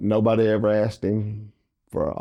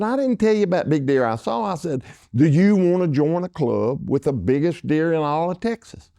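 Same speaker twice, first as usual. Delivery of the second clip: higher pitched mock rebuttal; arguing back; 7 semitones higher than usual. Parker arguing back at Dale, high mock rebuttal. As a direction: push back hard in the retold quarrel.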